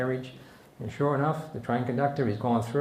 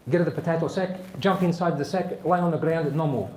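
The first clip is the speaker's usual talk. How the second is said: higher, clipped-sentence Italian orders; Italian accent; 4 semitones higher than usual. Acted. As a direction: give instructions in a mock Italian accent. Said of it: "the Italian goes".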